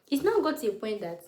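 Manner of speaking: usual speaking voice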